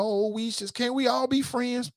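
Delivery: high-pitched mock peacemaker plea; mock pleading. Mocking plea for everyone's friendship.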